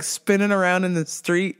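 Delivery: ordinary talk, nothing marked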